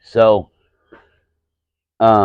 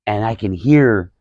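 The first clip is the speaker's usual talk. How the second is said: usual speaking voice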